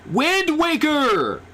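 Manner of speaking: slow, high drawn-out cheer; excited cry. Real, not acted. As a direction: stretch the title into a high cheer. Excited cheer at a game title.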